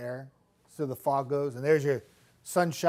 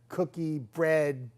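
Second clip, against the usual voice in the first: ordinary talk, nothing marked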